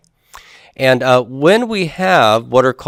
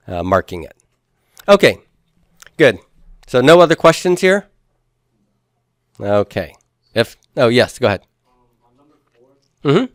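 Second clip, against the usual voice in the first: normal talking voice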